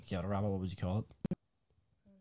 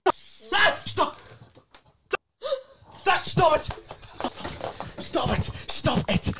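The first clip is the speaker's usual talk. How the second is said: shouting stop; 11 semitones higher, slower than usual. Real, not acted. Friend spray painting his house.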